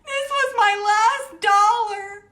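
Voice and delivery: high, loud, slow cry-laugh wail; mock wailing. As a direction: wail it half crying, half laughing. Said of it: "And she's like".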